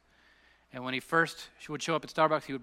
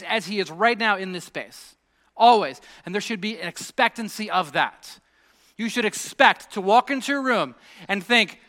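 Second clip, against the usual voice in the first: loud, raised-pitch sermon delivery; fervent preaching; 5 semitones higher, 9 dB louder than usual. Real, not acted. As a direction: press each point on the congregation with force. Preaches at full force.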